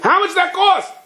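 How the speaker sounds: high, stunned question; shocked. Retold or imitated. Owner stunned by the mechanic's new engine, high and shocked. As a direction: shoot up high in disbelief.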